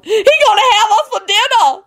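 high, panicked wail. A narrator's reading. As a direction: wail in comic terror, very high. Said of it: "Lucretia wailed".

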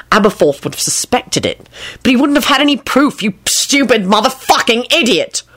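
exasperation climbing in pitch; barked scolding. A narrator's reading. Boss berating Lupin over the sign, barking. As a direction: build to the insult. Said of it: "he barked at Lupin".

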